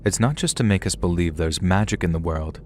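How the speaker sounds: ordinary delivery, nothing marked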